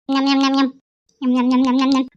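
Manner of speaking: nyam-nyam chomping on one even pitch; munching noises. Acted. Woman secretly tucking into mutton, greedy munching. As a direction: chomp through a big mouthful.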